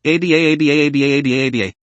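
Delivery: frantic wordless babble, over and over; a mute's frantic babbling. A narrator's reading. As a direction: babble in a panic, no words. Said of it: "His mouth is even more frantic".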